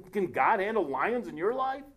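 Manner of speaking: usual speaking voice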